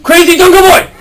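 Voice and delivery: loud call across the room; calling from far away. Real, not acted. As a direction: call out to him, loud and high. Calling the boy over.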